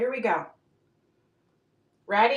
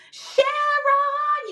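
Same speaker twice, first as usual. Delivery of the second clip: very high, drawn-out singsong call; 14 semitones higher than usual. Real, not acted. Seller spotting Sharon joining, calling out. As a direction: hail a friend with a long high holler. Calls out to arriving viewer.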